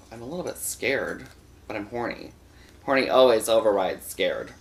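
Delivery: ordinary delivery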